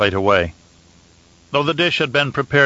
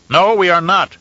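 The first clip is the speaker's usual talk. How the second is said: loud, high angry roar; 8 semitones higher, 5 dB louder than usual. A narrator's reading. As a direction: roar it out in fury. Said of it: "Shaddam roared".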